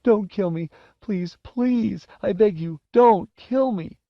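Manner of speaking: very high, wailing plea; terrified whimpering boy, last words drawn out; begging for mercy. Acted. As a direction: beg desperately for your life. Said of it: "begging for mercy".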